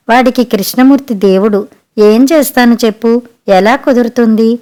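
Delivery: ordinary delivery, nothing marked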